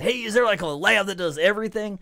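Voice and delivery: a hint of an alternate voice for the commenter; voiced Reddit commenter. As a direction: read the comment in a put-on voice. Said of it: "That's my own accent".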